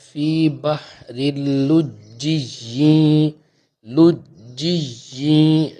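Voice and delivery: اللجی with doubled ج, slow chanted, then لجی held long; tajweed demo. Shown as a demonstration. Teacher drills the shadda in لجی for students, slow chant. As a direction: stress the shadda on ج in لجی, drawn out. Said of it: "لام جیم پیش لج".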